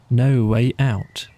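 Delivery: plain reading voice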